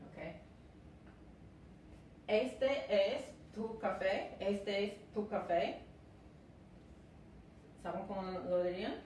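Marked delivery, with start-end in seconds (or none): none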